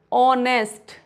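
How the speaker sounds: "ऑनेस्ट" starting on the vowel, no H; silent H. Shown as a demonstration. Teacher modelling the silent H rule, "ऑनेस्ट" without H. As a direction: say "ऑनेस्ट" with the H dropped. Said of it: "एच नहीं पढ़ेंगे".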